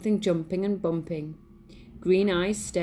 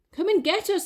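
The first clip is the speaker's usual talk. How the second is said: high loud taunt; teasing call; 12 semitones higher, 5 dB louder than usual. Acted. Mice teasing the cat from above, high loud taunt. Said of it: "they called".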